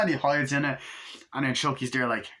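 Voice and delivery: normal talking voice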